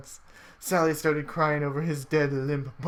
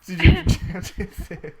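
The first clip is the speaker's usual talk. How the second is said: laughing; 2 semitones higher, 6 dB louder than usual. Real, not acted. Urging friend, laughing.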